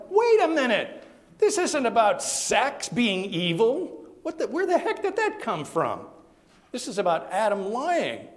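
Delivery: loud, high, incredulous protest; hammed-up indignation at a wrong reading; outraged objection. Own retold outraged objection.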